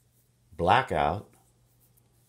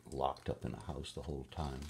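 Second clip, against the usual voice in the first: plain reading voice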